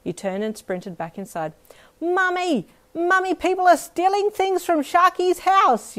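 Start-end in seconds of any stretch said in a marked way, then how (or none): 2.0-6.0 put-on high, loud, slow child's holler. Little girl hollering to her mum about the van, high and loud. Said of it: "you hollered as you ran".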